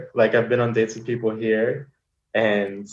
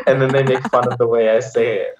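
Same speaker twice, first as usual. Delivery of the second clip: usual speaking voice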